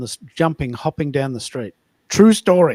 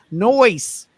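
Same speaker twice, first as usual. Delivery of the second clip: high, loud, drawn-out Melbourne "Noise"; hammed-up Melbourne twang on "Noise"; Melbourne accent; 7 semitones higher, 6 dB louder than usual. Shown as a demonstration. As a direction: exaggerate the Melbourne vowel, "nice" as "Noise". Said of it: "Melburnians would say".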